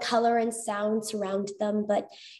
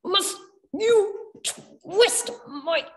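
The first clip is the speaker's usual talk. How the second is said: high, slow robot voice stammering in jolts; timid robot begging, slow and high with stutters; stuttering robot; 7 semitones higher, slower than usual. Acted. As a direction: plead like a glitching machine that stutters. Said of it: "The bot's flat voice came in jolts and starts".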